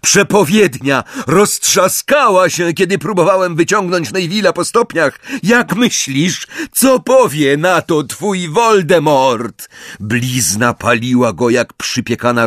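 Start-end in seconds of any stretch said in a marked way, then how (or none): 0.0-9.7 high, insistent calling out; raised-pitch goading; defiant taunt. A narrator's reading. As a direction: throw the news in an enemy's face. Harry taunts Bellatrix with bad news.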